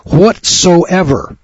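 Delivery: Whatsoever drawn out slow and high; emphasized word. Shown as a demonstration. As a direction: lean hard on Whatsoever, stretching it. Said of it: "Underline that".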